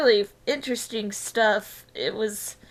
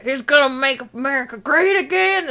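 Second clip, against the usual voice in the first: imitating dad; 3 semitones higher, 7 dB louder than usual. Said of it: "He's like".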